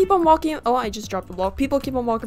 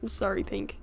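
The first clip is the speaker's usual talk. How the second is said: whispering; 7 dB quieter than usual. Real, not acted. Sneaking up on a player.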